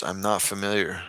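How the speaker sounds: normal talking voice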